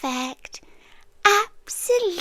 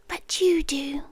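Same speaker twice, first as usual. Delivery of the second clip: ordinary talk, nothing marked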